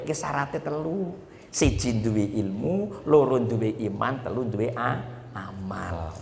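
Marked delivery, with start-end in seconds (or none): 1.5-6.1 slow, stressed count of the three conditions, a bit lower. Shown as a demonstration. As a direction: count off siji, loro, telu deliberately.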